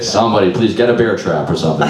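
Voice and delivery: usual speaking voice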